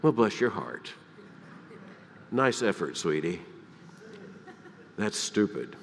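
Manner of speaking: soft, syrupy and condescending, ending in a low dismissive drop; condescending Southern put-down. Retold or imitated. Southern woman of stature dismissing a stupid effort, sugary scorn. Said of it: "thought it was the stupidest thing".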